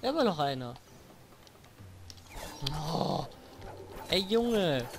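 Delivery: high, frantic panicked yelling; panicking gamer. Real, not acted. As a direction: panic with no health left. Low health, enemies shooting.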